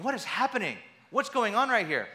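high, loud bewildered questions; confused disciples. Retold or imitated. Preacher voicing disciples baffled at the supper, loud and high. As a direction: voice the stunned disciples blurting out questions.

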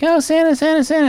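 put-on very high squealing kid voice; excited kids. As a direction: squeal for Santa like thrilled children. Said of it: "the children surround him and are like".

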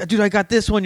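high, excited boast; excited bragging. Retold or imitated. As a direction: brag it with eager delight. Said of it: "then you're like".

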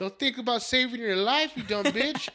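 high, loud mock outrage; exasperated retort. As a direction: fire back in comic disbelief. Retold retort to stubborn grandma.

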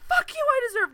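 high-pitched, loud imitation of near tears; mock screaming. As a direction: screech it like a hammed-up meltdown. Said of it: "because she is screaming, high-pitched".

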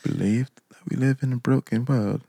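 soft, playful attempt at a British accent. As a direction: read it quietly in a mock British accent. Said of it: "Can you read it in a British accent?".

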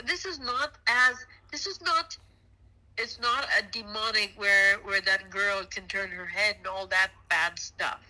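ordinary talk, nothing marked

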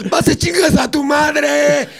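yelling it at him as he gets off the bus; retold yell. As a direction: hurl the insult after him as he leaves. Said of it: "me gritó".

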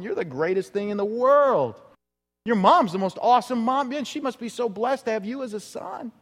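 a hint of starstruck praise; lightly voiced gushing woman. A fawning woman in the crowd praising Jesus, lightly mimicked. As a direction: mildly mimic a fawning fan. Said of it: "be like this woman".

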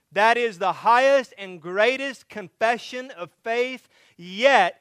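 loud, high, building sermon climax; preaching at full force. Acted. Pastor to congregation, preaching at full force. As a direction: preach it out with rising fervor to the congregation.

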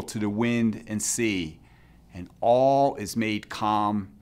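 ordinary delivery

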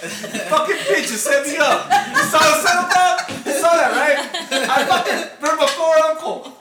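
playful furious shout through laughter; laughing outrage. Real, not acted. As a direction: yell at your friend while cracking up. Mock outrage at friend, laughing.

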